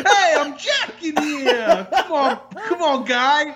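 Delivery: high, hammed-up Jersey street yell; mock outrage at a cab; irate pedestrian. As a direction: yell at a taxi like a Jersey guy. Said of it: "I'm imagining a taxi".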